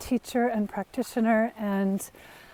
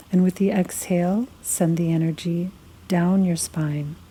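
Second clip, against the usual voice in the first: guided meditation; 4 semitones lower than usual. Acted. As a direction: lead them unhurriedly through a body scan, phrase by phrase. Leads a guided body-scan meditation.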